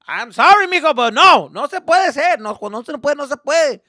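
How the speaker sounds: loud, high, firm no; dad refusing. Retold or imitated. Dad loudly telling his son they can't go. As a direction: shut the kid down hard.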